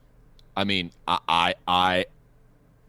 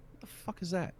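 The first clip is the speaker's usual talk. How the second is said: baffled, barely audible mutter; hushed disbelief; 8 semitones higher, 15 dB quieter than usual. Retold or imitated. Storyteller noticing something in his eye corner, hushed disbelief. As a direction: say it under your breath, thrown.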